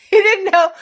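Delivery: loud, high burst of laughing disbelief; incredulous laughing. Real, not acted. Laughing in disbelief at his ignorance.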